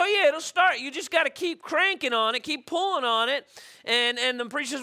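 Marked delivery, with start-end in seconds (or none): 0.0-3.5 a lightly voiced know-it-all kid; little boy voice. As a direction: play the cheeky boy, bright and sure.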